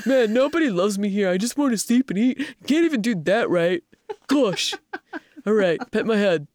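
put-upon, grumbling pet voice; Norman, cynical cat. Shown as a demonstration. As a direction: sulk like an unloved cat. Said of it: "so that's Norman's".

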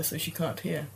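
quiet aside. Real, not acted. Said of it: "I'm going to whisper".

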